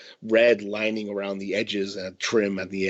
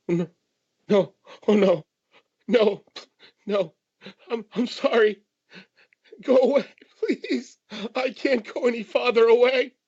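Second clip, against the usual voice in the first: high-pitched whimpering plea; crying man; 14 semitones higher, 3 dB louder than usual. Acted. Frightened stranger pleads to be left alone, whimpering. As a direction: whimper and beg, voice pushed way up. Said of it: "That's a crying man".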